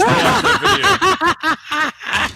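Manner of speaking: laughing through the words, slow. Real, not acted. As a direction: talk while cracking up. Laughing about the video.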